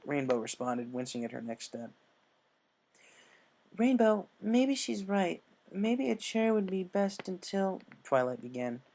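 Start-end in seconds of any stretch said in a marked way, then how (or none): 3.8-7.8 higher, slow, pleading friend; gentle concerned voice. Acted. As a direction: coax her slowly in a high voice.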